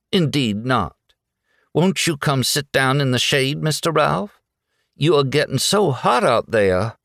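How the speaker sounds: high, sweet-talking Southern belle; genteel Southern hostess; lilting Southern voice. A narrator's reading. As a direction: coax him with a Southern lilt. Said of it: "lilting Southern voice".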